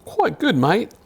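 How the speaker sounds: put-on high, jokey Australian accent; hammed-up Australian accent; accidental Australian. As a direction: slip into a mock Australian accent. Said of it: "I did turn into an Australian".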